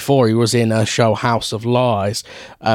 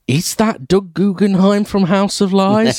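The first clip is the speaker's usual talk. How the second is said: high, drawn-out disbelieving question; incredulous recognition; 9 semitones higher, slower than usual. Retold or imitated. Film fan retelling spotting an actor on screen, incredulous. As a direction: stretch it out high, amazed to spot him. Acting out own surprised reaction watching.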